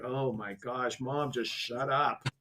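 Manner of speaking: low, quiet embarrassed mutter; mortified aside. Retold or imitated. Embarrassed family to mom in the line, mortified aside. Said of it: "All of us are looking the other way".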